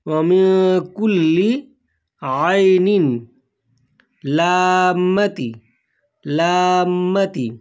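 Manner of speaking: আউযু বি কালিমাতিল্লাহি তাম্মাতি drawn out slowly, word by word; slow Arabic recitation. Shown as a demonstration. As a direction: recite each word slowly so learners can repeat it. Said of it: "আমার সাথে সাথে আপনিও বলবেন". (ধীরে ধীরে ভেঙে ভেঙে তিলাওয়াত)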